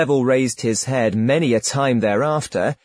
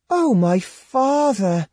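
put-on much higher, slow girlish voice; innocent reply; 9 semitones higher, slower than usual. A narrator's reading. Girl innocently agrees to the hermit, high slow voice. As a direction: answer sweetly and naively. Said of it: "she innocently replied".

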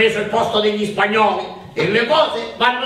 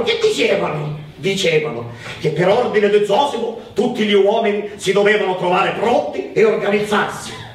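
ordinary talk

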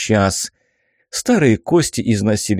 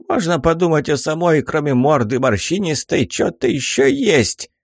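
put-on high taunting old man; cranky old geezer jeering; 5 semitones higher than usual. Old man mocks the crone.

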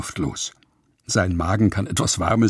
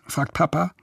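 ordinary delivery, nothing marked

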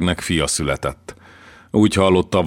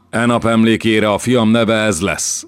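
solemn proclamation; 6 semitones higher, 4 dB louder than usual. A narrator's reading. Prince proclaims son's name.

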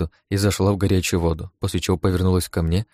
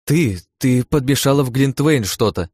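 slow, higher-pitched accusation; mildly indignant, drawn out; displeased accusation; 6 semitones higher, 4 dB louder, slower than usual. A narrator's reading. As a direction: accuse her, put out and slow. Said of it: "недовольно буркнула Лия".

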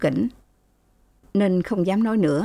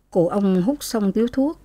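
usual speaking voice

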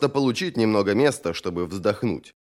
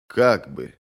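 low, sheepish hedge; reluctant, lowered answer; evasive; 8 semitones lower than usual. A narrator's reading. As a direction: dodge the question, pitched well down.

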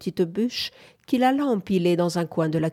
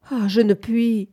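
higher, louder cry of helplessness; despairing moan; 4 semitones higher, 5 dB louder than usual. A narrator's reading. Woman unable to yield to her suitor, despairing moan. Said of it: "gémit-elle désespérément".

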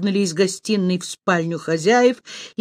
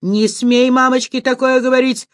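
high, loud acted screech; raised-pitch furious scolding; indignant squeal; 6 semitones higher, 4 dB louder than usual. A narrator's reading. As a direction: shriek in outrage, slowing on the final insult. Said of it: "взвизгнула Ирочка".

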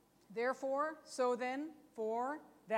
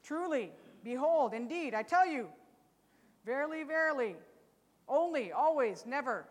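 each word hit louder as a demo; emphatic; 2 semitones higher, 6 dB louder than usual. Shown as a demonstration. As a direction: proclaim every word with weight. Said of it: "emphatic statements".

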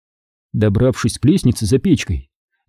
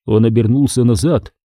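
usual speaking voice

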